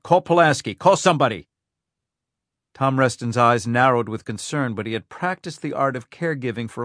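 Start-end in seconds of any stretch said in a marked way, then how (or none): 0.0-1.5 louder, higher urgent commands; urgent orders. Acted. Rhyme urges his aide to call for help. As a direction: bark the orders fast.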